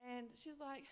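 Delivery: ordinary talk, nothing marked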